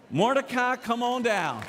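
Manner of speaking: high announcer-like summons; calling out. Acted. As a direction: call the winner up to the front. Calling Mordecai up like a host.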